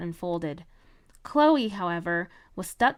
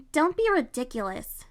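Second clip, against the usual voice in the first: snippy, dismissive, higher pitch; with attitude; 4 semitones higher than usual. Acted. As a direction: brush him off with attitude. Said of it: "she said with an attitude".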